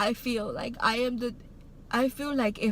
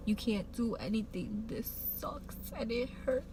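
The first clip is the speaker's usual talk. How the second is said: softer, whispering the brother's words; whispered note; 8 dB quieter than usual. Retold or imitated. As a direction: breathe it out like a note from a hurting brother. Reading brother's note in pain.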